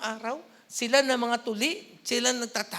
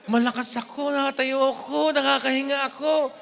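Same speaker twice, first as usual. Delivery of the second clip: louder proud gratitude; joyful thanks; 2 semitones higher, 8 dB louder than usual. Pretend. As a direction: declare your strength with joy. Modelling grateful self-talk.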